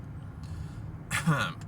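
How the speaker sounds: clears throat hard; quick raspy throat clear. Real, not acted. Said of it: "Got a frog in my throat".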